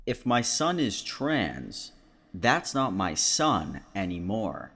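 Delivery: slow, deliberate repeat for emphasis; pointed re-read. Shown as a demonstration. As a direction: spell it out slowly so it sinks in. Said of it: "Should I read that one one more time".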